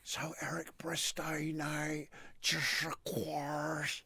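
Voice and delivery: slow, hushed whisper of darts commentary; whispered commentator. Retold or imitated.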